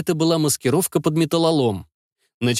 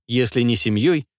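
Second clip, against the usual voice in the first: usual speaking voice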